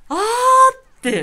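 a long, drawn-out high gasp of awe; awed gasp. Retold or imitated. Fan reliving the moment the spotlight hit the star, awed gasp.

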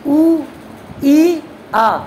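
sounding out ऊ, then ई and आ, each vowel held on its own; vowel sounds demonstrated. Shown as a demonstration. Teacher shows students how the vowels sound, clearly sounded out. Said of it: "जब बोल के देखना पड़ेगा".

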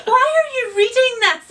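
high, loud horrified question; shocked mock outcry. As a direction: mum aghast at the reading. Mum horrified at dead-body book.

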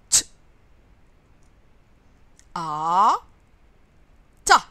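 T and A said as separate sounds, then blended into "Ta"; sounding out. Shown as a demonstration. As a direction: sound out T, long A, pause, then a joined Ta. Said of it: "en prenant le son de chaque lettre et en collant les sons".

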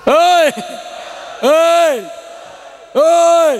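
sharp call to the listeners. Real, not acted. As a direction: shout hei to get attention. Preacher calls out to crowd.

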